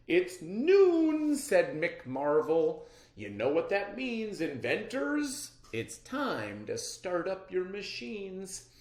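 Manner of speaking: high, loud announcer voice; showman host. A narrator's reading. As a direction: call it out to the crowd like a showman. Host announces start to inventors.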